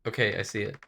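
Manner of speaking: ordinary talk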